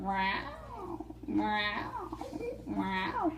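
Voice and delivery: quiet, sly cat meows; soft meow. Acted. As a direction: purr out a sly little meow. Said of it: "It was Señor Gato".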